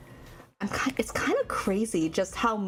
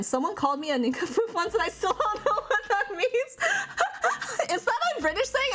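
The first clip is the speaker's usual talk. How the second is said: high, giggling through the words; giggly retelling through laughter; laughing; 11 semitones higher than usual. Real, not acted. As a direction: laugh while telling it. Laughs telling nincompoop story.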